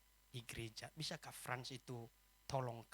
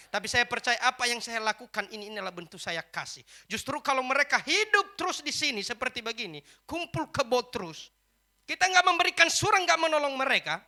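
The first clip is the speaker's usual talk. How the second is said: loud, high-pitched sermon delivery; impassioned preaching; 9 semitones higher, 17 dB louder than usual. Acted. Preacher defends helping unmarried couples, loud and impassioned. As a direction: press the argument forcefully on the congregation.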